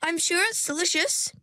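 high, meek character voice; small, polite voice. A narrator's reading. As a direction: lift the voice high, unconvinced but polite. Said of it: "said Ringlet in a firm voice".

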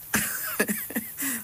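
laughing while choking. Real, not acted. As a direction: choke and laugh at once. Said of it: "I'm choking on my".

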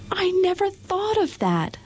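put-on high, bright girlish delight; light up like a girl with an idea; joyful Dorothy. A narrator's reading. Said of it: "said Dorothy joyfully".

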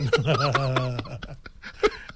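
amused chuckling run; laugh. Real, not acted. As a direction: burst of real laughter. Joking between the two hosts.

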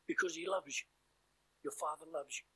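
ordinary delivery

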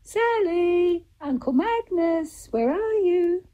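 very high, sing-song call. Acted. A voice searching for Sally and Uncle Magnus, calling out. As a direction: call them in from far away, long high notes. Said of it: "until there was a voice".

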